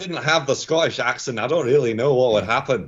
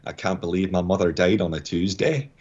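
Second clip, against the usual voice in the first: low Scottish accent, mock-tragic; heavy drama line with a Scottish brogue; 6 semitones lower than usual. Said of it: "like, a really heavy drama".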